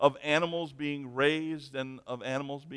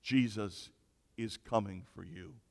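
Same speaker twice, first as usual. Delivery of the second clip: low, measured warning; a lightly voiced mystery presence, pitched down; ominous unseen voice; 6 semitones lower, 3 dB quieter than usual. Hidden voice warning a burglar, low and ominous. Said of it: "suddenly he hears the voice".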